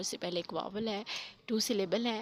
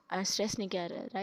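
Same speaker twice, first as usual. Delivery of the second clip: ordinary talk, nothing marked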